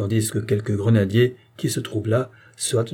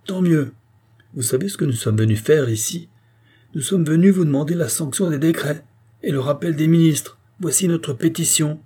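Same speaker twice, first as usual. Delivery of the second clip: higher, emphatic declaration; insistent demand; 6 semitones higher than usual. A narrator's reading. Butcher to the king for the people, insistently. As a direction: put demands forcefully.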